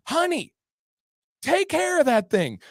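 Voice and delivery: high-pitched nagging plea; mock exasperated husband. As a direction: imitate a husband fed up with the cat. Husband snapping about the cat.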